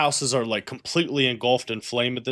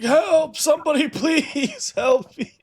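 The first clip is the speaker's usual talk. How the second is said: high, desperate wounded man crying help; a dying man begging anyone for help; fluttering cries for help; 13 semitones higher, slower than usual. Acted. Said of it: "and he's like".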